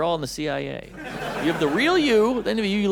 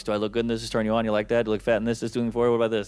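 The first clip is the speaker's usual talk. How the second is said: low, droning string of needy questions; mock insecurity; 8 semitones lower, much faster than usual. Comic mimics women fretting over looks, mock-insecure. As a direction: imitate insecure women fishing for reassurance, pitched low. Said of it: "some of the most insecure people".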